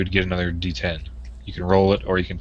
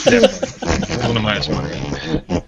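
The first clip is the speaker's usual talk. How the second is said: sarcastic; 3 semitones higher, 4 dB louder than usual. Real, not acted. Teasing disbelief.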